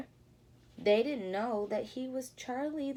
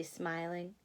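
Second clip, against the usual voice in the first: usual speaking voice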